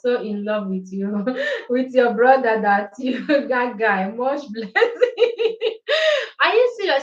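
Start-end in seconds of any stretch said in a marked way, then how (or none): 4.1-5.6 laughing through the words. Real, not acted. Laughing at a viewer's comment. 6.4-7.0 high, loud surprised question. Real, not acted. Surprised reply to a viewer's comment.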